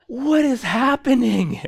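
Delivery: high, slow, loud disbelief; hammed-up incredulous wail; retold bewilderment. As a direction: stretch it out, utterly baffled, for comic effect. Stunned reaction to the shouting.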